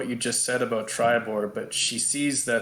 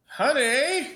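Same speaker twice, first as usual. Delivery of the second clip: high, singsong call to a spouse; yelling out; 12 semitones higher than usual. Acted. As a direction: call into the house in a high put-on voice. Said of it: "And she yells out".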